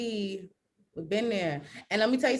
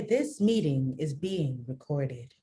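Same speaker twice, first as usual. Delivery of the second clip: lower, slow telemarketer voice; 9 semitones lower, 4 dB quieter, slower than usual. Shown as a demonstration. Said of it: "my fake telemarketer voice".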